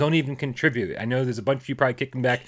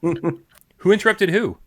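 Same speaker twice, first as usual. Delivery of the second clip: incredulous; 7 semitones higher, 4 dB louder, much slower than usual. Real, not acted. Confused by the apology.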